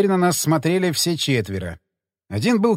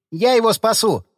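high, bold declaration; eager announcement; 6 semitones higher than usual. A narrator's reading. Terry volunteers to save the pastor, eager high call. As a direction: announce it boldly, pitched up. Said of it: "оповестил нас Терри".

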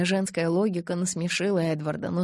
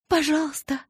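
high, louder desperate plea; pleading; 9 semitones higher, 4 dB louder than usual. A narrator's reading. Bella begging Edward, high and louder. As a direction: beg him with everything. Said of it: "умоляла я".

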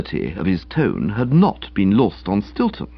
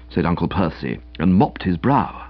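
usual speaking voice

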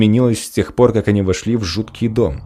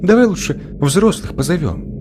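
high, whiny plea; whining; 9 semitones higher than usual. Acted. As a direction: whine like a scared little girl. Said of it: "заныла Аля".

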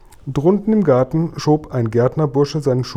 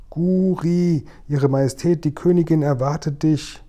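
higher-pitched call into the garden; 5 semitones higher than usual. A narrator's reading. Sophiechen announces the Queen to the giant in the garden, calling out. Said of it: "rief in den Garten".

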